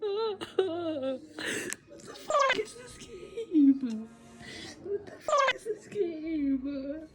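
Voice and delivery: high, slow, crying disbelief; sobbing. Real, not acted. Playing the game last night, real sobbing in disbelief. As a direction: sob it out, devastated. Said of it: "this was my reaction after I played it last night".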